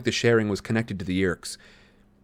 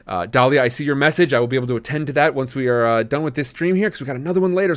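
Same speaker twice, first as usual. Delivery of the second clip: usual speaking voice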